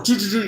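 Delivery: high-pitched starter noises; imitated cranking engine. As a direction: mimic an engine turning over without firing. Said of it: "it would turn over".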